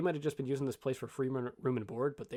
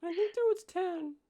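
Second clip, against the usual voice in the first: put-on very high little kid voice; small child; 4 dB louder than usual. Young halfling answering the adults' questions, in a high child voice. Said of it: "this child cannot".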